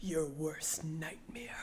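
slow, soft mock-ominous whisper; menacing whisper. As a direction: imitate a dramatic movie threat. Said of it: "And he's like".

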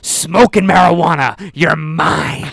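booming, dragged-out bust announcement; mock-menacing cop making his catch; hammed-up cop. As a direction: play the cop like a wrestling promoter. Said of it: "What is this, Vince McMahon?".

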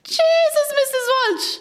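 exasperated exclamation. Acted. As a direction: throw up your hands with a high cry.

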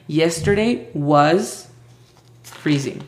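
slow, carefully spaced English; slow model answer. Shown as a demonstration. Teacher repeating Aimee's answer, slow and clear. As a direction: lay each word out slowly. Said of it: "Entonces utilizó".

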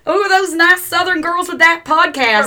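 a mock southern girl, hammed up and slow; southern accent. Podcaster imitating how fans describe them, in a southern accent. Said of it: "my southern accent".

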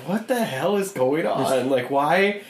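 exasperated what-is-this reaction; baffled reaction. Retold or imitated. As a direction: draw it out in bafflement. Acting out bafflement at the plot.

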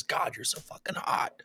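breathy sultry whisper of a come-on; mock whisper. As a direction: whisper it like a cheesy pickup line. Mock dating app message.

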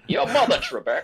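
high, laughing Scottish taunt; Sean Connery. Acted. Contestant insulting the quiz host, mock Scottish taunt. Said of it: "best Sean Connery impersonation".

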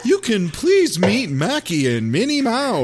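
high mock PA call; lightly hammed loud announcer; loud announcement. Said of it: "they say it really loud".

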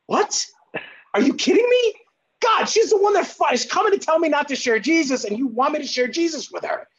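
high-pitched, disbelieving outburst; incredulous protest. Retold or imitated. Acts out protesting to God.

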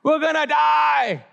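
drawn-out high wail of mock panic; freaking out. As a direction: wail it slowly like a hammed-up doomed sailor. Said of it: "they're freaking out".

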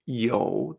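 "you" low and dipping; drawn-out low third tone on "you". Shown as a demonstration. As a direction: sink "you" to the bottom of the voice.

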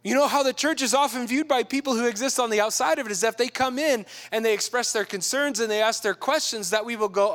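ordinary talk, nothing marked

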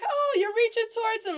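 very high, hammed-up oh; high mock swooning; mock gushing. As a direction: play the eager girl in a high voice. Acting the girl reaching for him.

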